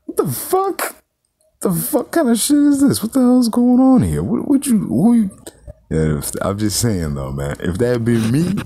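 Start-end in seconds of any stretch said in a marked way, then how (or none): 0.0-5.3 high, loud, disbelieving yelp; stunned outburst. Retold or imitated. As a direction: shriek your outrage at the screen. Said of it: "I'm saying, like".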